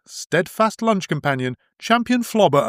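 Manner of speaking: ordinary talk, nothing marked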